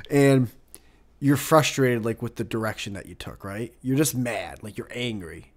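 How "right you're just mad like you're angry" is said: ordinary talk, nothing marked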